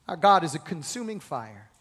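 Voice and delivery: ordinary talk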